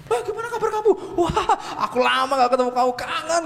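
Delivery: high, bubbly and fast with a laugh; gushing friendly greeting. Acted. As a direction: greet an old friend with delight. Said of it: "gitu kan".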